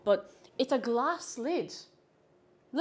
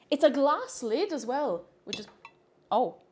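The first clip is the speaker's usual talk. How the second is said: ordinary talk, nothing marked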